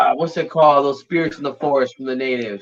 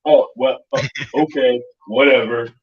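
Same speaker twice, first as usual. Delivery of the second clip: lower, deflated retreat; grudging mock climb-down; backing down; 4 semitones lower than usual. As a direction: mimic a tough guy losing his nerve. Tough guys backing down in retold exchange.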